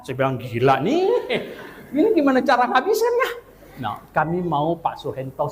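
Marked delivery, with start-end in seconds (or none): 0.4-3.3 laughing disbelief. Retold or imitated. Quoting himself at a huge feast.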